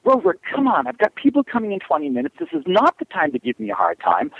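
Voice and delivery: high, wordy nagging at the dog; pleading woman. Acted. Woman expecting visitors pleads with Rover, high nagging imitation. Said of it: "Women will go".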